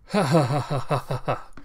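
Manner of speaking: loud, rapid run of ha-has; acted laughing fit. Ito laughs after cursing at the river, acted laughing fit. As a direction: break into a stagey laugh.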